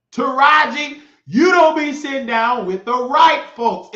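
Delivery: high, loud outburst of anger; furious shout. Real, not acted. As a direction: yell them down in real fury. Furious rant at Roland and Taraji.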